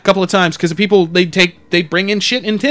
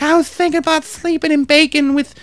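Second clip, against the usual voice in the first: high, put-on nonsensical ramble; rambling crazy lady; 8 semitones higher than usual. Crazy lady off on a tangent, high rambling imitation. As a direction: drift along in a raised mock voice. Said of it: "went off on this weird fucking spew of words".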